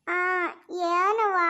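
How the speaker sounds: very drawn-out, sleepy answer; groggy. Acted. Daughter woken from sleep answers mother. (ನಿದ್ದೆಗಣ್ಣಿನ ಎಳೆದ ಧ್ವನಿ)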